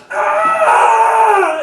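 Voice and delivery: long high-pitched comic "aah" scream; mock scream. Six-year-old getting crashed into, mock scream. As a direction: imitate a kid screaming, high and sustained. Hit kid screams in retold story.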